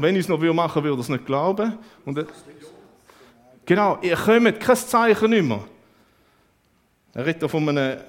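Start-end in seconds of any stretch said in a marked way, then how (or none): 0.0-5.6 loud, high fervent preaching; preaching at full force. Acted. Preacher proclaiming Jesus' answer to the congregation, loud fervent preaching. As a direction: build to a ringing pulpit climax.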